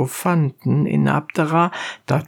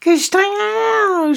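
high-pitched character yell; shrieked appeal of a donkey driver; donkey driver's cry; much faster than usual. A narrator's reading. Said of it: "schrie der Eseltreiber".

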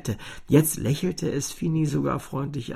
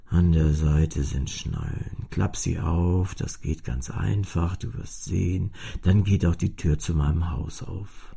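deutlich tiefer, langsam und freundlich; tiefe Kastenstimme; 8 semitones lower than usual. A narrator's reading. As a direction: sprich als alter, ruhiger Kasten. Kasten spricht zu Fini. (tiefe, langsame Stimme des Kastens)

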